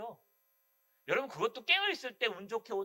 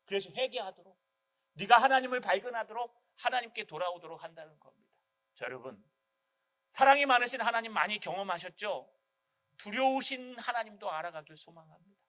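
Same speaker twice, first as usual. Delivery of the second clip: ordinary talk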